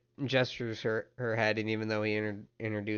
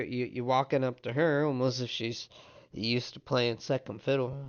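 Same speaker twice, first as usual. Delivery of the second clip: usual speaking voice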